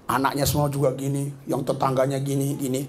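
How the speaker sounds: hushed admiring chatter; gossipy praise. Retold or imitated. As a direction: imitate neighbours fawning in hushed tones. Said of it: "semua memuji-muji".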